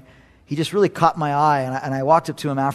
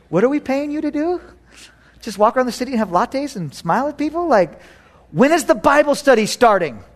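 higher, incredulous questions; mock disbelief; 8 semitones higher than usual. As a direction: scoff at a plan. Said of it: "I was like".

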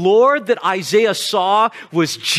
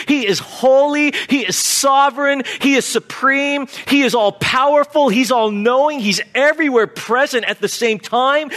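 rising sermon climax; 4 semitones higher than usual. Real, not acted. Pastor to the congregation, building to a fervent preaching climax. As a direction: pile up each attribute, building to full force.

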